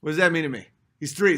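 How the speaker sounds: normal talking voice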